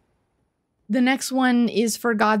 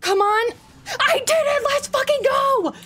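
triumphant shout. Real, not acted. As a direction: scream it like you just won. Finally beats the boss.

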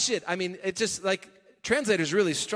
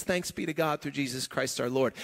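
hushed relief; 7 semitones lower, 4 dB quieter than usual. Acted. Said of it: "He says".

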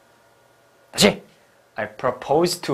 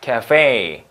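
카페 said as English café, stress on the last syllable; American-style 카페, second syllable stressed; American end stress; 4 dB louder, much slower than usual. Shown as a demonstration. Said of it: "강세가 뒤에 있어요".

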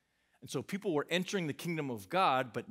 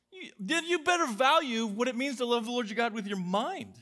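much higher, louder and driving; fired up; 9 semitones higher, 6 dB louder than usual. Real, not acted. Preacher firing up college students about loving God with their mind. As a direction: preach it hard, pressing every word. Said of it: "I start to get fired up".

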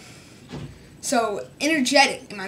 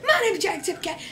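laughing Jacksepticeye; 6 semitones higher, faster than usual. Retold or imitated. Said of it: "I'm not Jacksepticeye".

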